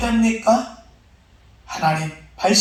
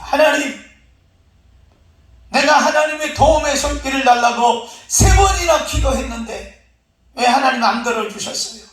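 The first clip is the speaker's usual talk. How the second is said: high-pitched protest to God; indignant complaint; 6 semitones higher than usual. Acted. Drowned man confronts God in heaven, protesting. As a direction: argue your case, pressing the grievance. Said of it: "그러자 따집니다".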